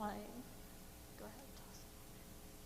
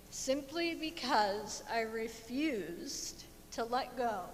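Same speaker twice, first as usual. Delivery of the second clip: ordinary talk, nothing marked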